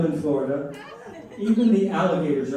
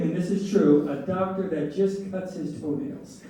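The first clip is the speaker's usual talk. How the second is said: normal talking voice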